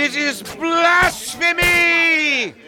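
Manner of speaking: slow, high, furious outcry of blasphemy; drawn-out indignant yell; outraged shout. Acted. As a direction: roar it out, scandalized. High priest condemns Jesus, outraged.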